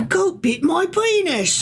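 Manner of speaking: mock defendant.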